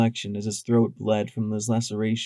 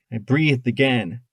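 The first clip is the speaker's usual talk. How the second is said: ordinary delivery, nothing marked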